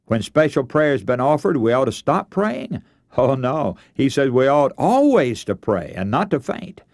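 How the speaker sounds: ordinary delivery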